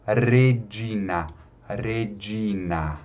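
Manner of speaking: "Regina" with a trilled initial R; vibrant R. Shown as a demonstration. Teacher modelling Latin R, "Regina" with trilled R. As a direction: roll the R that starts "Regina". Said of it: "tem um som vibrante".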